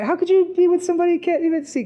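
high, fast, incredulous scoff; mocking skeptic friend. Coworker scoffing at his chastity, high and fast. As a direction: scoff at him like he's insane.

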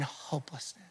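a breathy whisper, barely there; whispered hopelessness. Acted. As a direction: let the word fade into a whisper. Sermon climax on John's despair.

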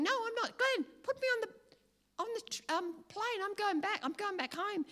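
high, pleading panic to her husband; panicked pleading. Retold or imitated. Wife pleading with husband Glenn to fly her home, high panicked voice.

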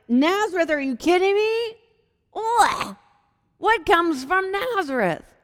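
high, loud, incredulous sneer; disgusted scoff. Retold or imitated. Nathanael scoffs at Philip's news, high disgusted sneer. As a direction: scoff at the very idea of Nazareth.

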